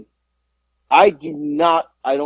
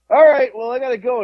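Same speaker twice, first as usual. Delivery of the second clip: eager to leave; 9 semitones higher, 3 dB louder than usual. Retold or imitated. Quoting himself ending call.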